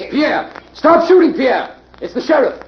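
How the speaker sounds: high, loud call across the woods; frantic shout into the trees at the shooter. Acted. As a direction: yell at him to stop firing. Calling out to the shooting trapper.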